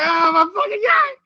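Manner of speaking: high loud shocked outcry; excited outcry. Retold or imitated. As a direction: squeal it in disbelief.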